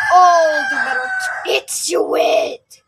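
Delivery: loud growl of a wolf. Acted. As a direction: snarl it out like a hungry wolf. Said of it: "growled the wolf".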